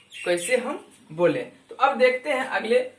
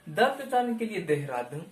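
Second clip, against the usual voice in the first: ordinary delivery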